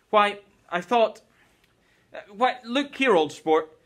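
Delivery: a hint of Gatsby fumbling for the words. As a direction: stumble over how to begin. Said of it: "he fumbled with a series of beginnings".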